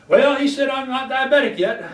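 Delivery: mock the patient brushing off the warning, fast; complacent patient. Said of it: "They just said".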